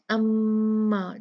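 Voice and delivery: अम्मा with a long nasal hum on मम; ghunna on the doubled m. Shown as a demonstration. Quran teacher showing students ghunna, slow and nasal. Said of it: "जब भी नून और मीम मुशद्ददा".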